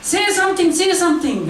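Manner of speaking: a panicky voice in his head pushing him; mock inner scream. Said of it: "this voice screams in my head".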